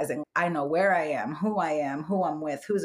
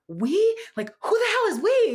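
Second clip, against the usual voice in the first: high, loud disbelieving question; incredulous; 4 dB louder than usual. Retold or imitated. Hearing voices in the shower, loudly incredulous. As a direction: demand to know who's talking.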